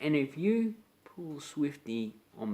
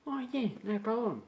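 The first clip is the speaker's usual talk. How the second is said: easygoing mate; 11 semitones higher than usual. Retold or imitated. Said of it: "Then you go".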